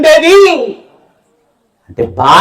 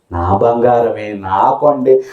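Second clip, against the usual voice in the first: lower, softer, slow doting coo; doting mother; 10 semitones lower, 5 dB quieter, slower than usual. Acted. Mother fondly praising her son's stolen goods, lower and soft. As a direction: croon over the son like a proud mother.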